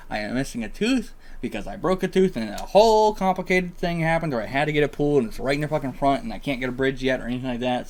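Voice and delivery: ordinary delivery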